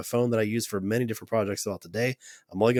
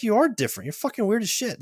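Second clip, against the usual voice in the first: much higher, emphatic blunt verdict; 11 semitones higher than usual. Retold or imitated. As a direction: lay it on him straight, pitched way up. Therapist bluntly telling him.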